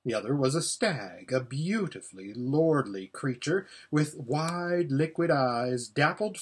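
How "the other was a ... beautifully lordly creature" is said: ordinary delivery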